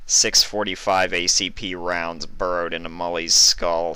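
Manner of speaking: ordinary delivery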